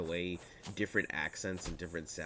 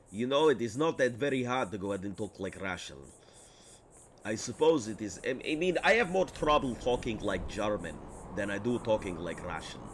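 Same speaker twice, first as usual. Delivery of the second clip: lightly put-on Russian accent, a bit louder; 2 semitones higher, 3 dB louder than usual. Answering a chat request to talk Russian, light Russian accent. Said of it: "Talk Russian".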